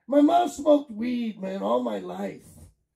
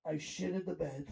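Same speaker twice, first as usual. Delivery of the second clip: ordinary delivery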